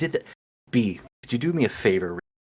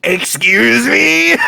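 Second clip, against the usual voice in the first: mock offended; 10 semitones higher, 8 dB louder, much slower than usual.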